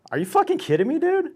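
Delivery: high-pitched disbelief; retold indignant question; incredulous protest. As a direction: protest a false accusation, voice climbing. Reenacts disbelief at being accused.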